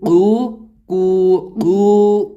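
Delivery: teacher drills "قو" for pupils, held long; drawn-out syllable drill. Shown as a demonstration. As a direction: repeat "قو" slowly, stretching the vowel. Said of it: "جیسے کہ".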